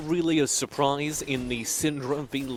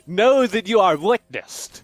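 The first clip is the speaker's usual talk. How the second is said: grand dramatic declaration; 5 semitones higher, 8 dB louder, slower than usual. Acted. Mock dramatic reply.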